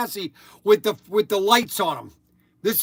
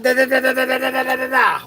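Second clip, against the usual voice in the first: gun noises; 5 semitones higher, 4 dB louder, faster than usual. Retold or imitated. Mimics kids' toy gun fire.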